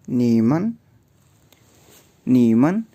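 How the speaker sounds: नीमन said as ni-men with a clear rise; teacher models नीमन slowly for learners; Chinese word demo. Shown as a demonstration. Teacher drilling नीमन for students, rising demo. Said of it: "नी के एंड पे अगर हम मन लगा देंगे".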